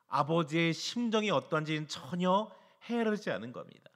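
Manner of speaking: ordinary talk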